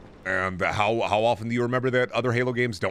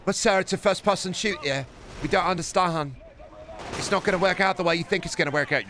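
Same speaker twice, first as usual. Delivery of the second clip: meek underling voice; 7 semitones higher than usual. Acted. Acted developers answering Sony.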